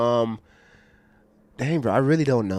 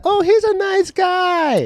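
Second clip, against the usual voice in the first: very high, slow, gushing voice; surprised admirer; 4 dB louder, much slower than usual. Retold or imitated. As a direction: stretch it out high like a charmed onlooker. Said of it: "they're like".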